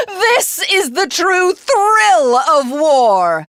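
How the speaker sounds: put-on villain drunk on the thrill of war; rapturous. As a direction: relish every word like a mad conqueror. Villain revels in victory.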